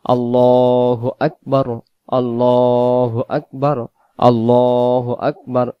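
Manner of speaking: slow আল্লাহু আকবার with thick dark l; slow chant-like recitation; heavy lam. Shown as a demonstration. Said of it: "ল পরে পড়তে হবে".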